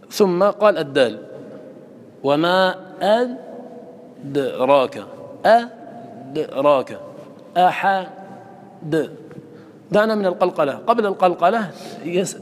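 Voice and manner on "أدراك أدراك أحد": very slow أدراك with sound trapped on the د; silent stop on dal. Shown as a demonstration. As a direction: halt on the د of أدراك, then bounce it out. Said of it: "تسكت على الحرف".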